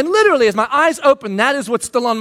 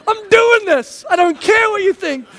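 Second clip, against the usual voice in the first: defiant boast; 10 semitones higher, 4 dB louder than usual. Retold or imitated. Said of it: "I shut the door 'cause I'm like".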